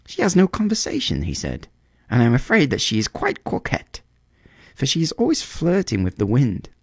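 a small bird grumbling about his lady love; swallow complaining. A narrator's reading. Swallow grumbles about the reed, high fussy bird voice. Swallow voice, higher than narration.

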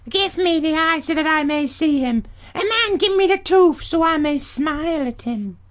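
very high crone voices, alarmed then pleading; wheedling old hags. Acted. Grey sisters plead with the young stranger in high hag voices. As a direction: ancient hags begging sweetly for their eye.

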